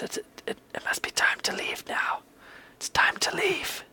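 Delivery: hushed, hesitant stage whisper; urgent whisper. Acted. Dorhan ushers guests out after the outburst, whispering. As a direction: whisper it as a discreet nudge to go. Dorhan urges party out discreetly.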